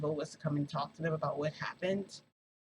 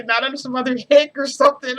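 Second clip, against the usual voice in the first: much higher and louder, laughing mock-quote; 8 semitones higher, 15 dB louder than usual. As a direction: crack up while quoting him. Retelling absurd line, laughing loudly.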